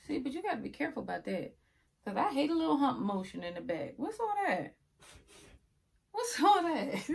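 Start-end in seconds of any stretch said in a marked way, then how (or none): 4.0-4.7 mock surprise. Mock shock at wig hump. 6.1-7.1 mock surprise. Mock shock at wig hump.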